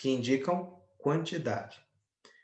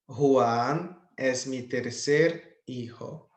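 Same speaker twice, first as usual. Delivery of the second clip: tercer said slowly, with the final o dropped; corrected form, slow; much slower than usual. Shown as a demonstration. Teacher to students, slowly modelling corrected tercer hijo. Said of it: "vou tirar a letra O no final".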